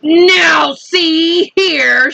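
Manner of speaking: high, very slow called-out warning; police chief shouting up at the centipede; shouted. A narrator's reading. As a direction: call across to the peach. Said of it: "shouted the chief of police".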